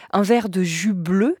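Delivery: ordinary talk, nothing marked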